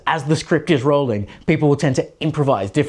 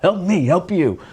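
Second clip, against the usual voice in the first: a put-on movie accent, a bit higher, on Help me, help you; wrong accent; 4 semitones higher than usual. Vlogger jokingly quotes a movie line to viewers, in the wrong accent. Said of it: "wrong accent for the wrong movie".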